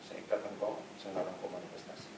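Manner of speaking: very soft, low murmured rebuke; quiet command. Retold or imitated. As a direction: bind it under your breath, barely audible. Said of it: "Saya ngomongnya pelan". (perintah pelan, nyaris berbisik)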